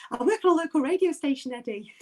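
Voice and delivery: sheepish self-introduction. Retold or imitated. Retelling her awkward reply to Eddie.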